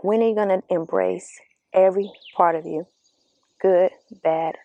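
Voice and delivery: ordinary delivery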